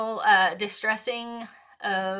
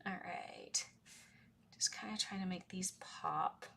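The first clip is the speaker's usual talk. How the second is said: whispered aside; 2 semitones lower, 14 dB quieter than usual. Real, not acted. Whispering while drawing.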